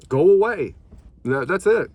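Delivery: sudden loud, high angry outburst; angry shout. Real, not acted. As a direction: snap it out in rage. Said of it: "what just happened right there, that happened from carnivore".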